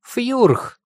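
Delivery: very high, falling yelp; guilty creature squeak. A narrator's reading. Two monsters answering angry mistress, guilty squeak. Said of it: "виновато выдохнули оба монстра".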